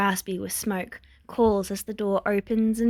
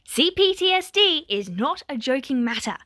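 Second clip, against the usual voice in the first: sharp, raised angry retort; a touch of angry spitting; spitting back; 5 semitones higher, 4 dB louder than usual. A narrator's reading. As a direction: spit the words back. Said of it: "Fuyumi spits back".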